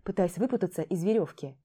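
usual speaking voice

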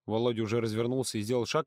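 ordinary delivery, nothing marked

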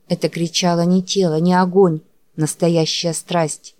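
ordinary delivery, nothing marked

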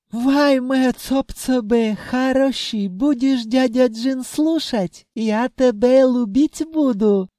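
put-on high djinn babble, accented Russian; coaxing djinn. A narrator's reading. Djinn talks to the treasury wall, coaxing. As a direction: croon promises in a high djinn voice. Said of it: "бурчал себе под нос".

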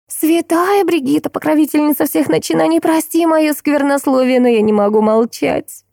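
mock prayer. A narrator's reading. Friend invoking a saint over gossip, mock-praying.

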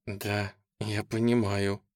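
slow words forced through gritted teeth. Acted. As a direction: grind it out through clenched teeth. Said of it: "процедила сквозь зубы она".

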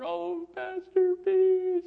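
very high, slow, drawn-out mock wail; imitated crying. Woman hearing her pastor on the phone, mock crying. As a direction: imitate someone bursting into tearful joy. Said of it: "She just started crying".

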